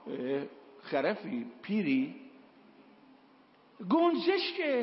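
losing patience, yelling the last word; angry son. Retold or imitated. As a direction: snap at the old father in exasperation. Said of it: "این پسر عصبانی".

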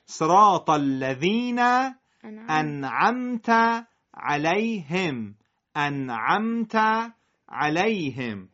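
slow, careful Arabic انعمت with a clear ʕ; Arabic recitation. Shown as a demonstration. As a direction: recite انعمت علیهم slowly in proper Arabic. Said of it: "در نماز شما اون رو قرائت". (قرائت عربی آهسته)

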